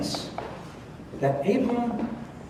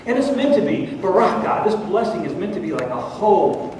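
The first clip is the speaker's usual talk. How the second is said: normal talking voice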